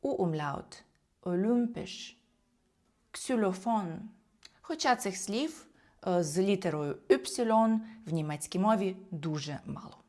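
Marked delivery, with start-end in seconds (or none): 1.2-4.1 олюмпіш and ксюлофон with rounded ü; German ü in олюмпіш and ксюлофон; Y as U-umlaut. Shown as a demonstration. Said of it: "або всередині слова як".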